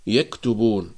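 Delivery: ordinary talk, nothing marked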